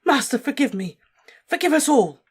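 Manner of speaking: high, loud terrified pleading; begging shriek. A narrator's reading. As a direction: beg desperately at full voice. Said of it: "he shrieked".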